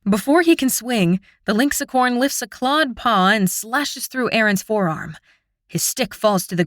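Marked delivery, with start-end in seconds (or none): none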